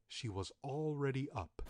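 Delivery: usual speaking voice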